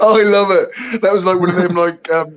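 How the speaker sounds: loud, high, laughing; laughing delight. Real, not acted. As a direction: gush through laughter. Laughing at muted guest mishap.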